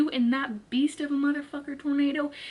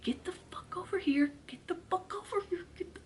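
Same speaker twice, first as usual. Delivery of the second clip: urgent whisper; 6 semitones higher, 12 dB quieter than usual. Retold or imitated. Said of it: "I'm thinking".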